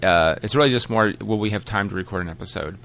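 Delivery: ordinary talk, nothing marked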